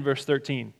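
ordinary delivery

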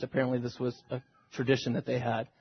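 normal talking voice

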